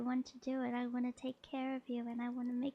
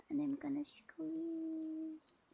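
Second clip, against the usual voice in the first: slow, higher, stretching the last word out long; drawn-out squeeze; 4 semitones higher, much slower than usual. Pretend. Streamer squeezing the listener in a pretend hug, drawn out.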